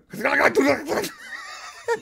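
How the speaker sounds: high, harsh guttural nonsense; caricatured angry German noises; mock German gibberish. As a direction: spit out fake German throat sounds. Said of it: "in, in, uh, German".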